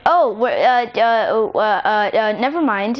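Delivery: embarrassed string of false starts; flustered stammering. Acted. Caught puckering up for a kiss, flustered stammering. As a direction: stammer awkwardly, then give up.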